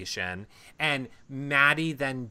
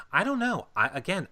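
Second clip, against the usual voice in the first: usual speaking voice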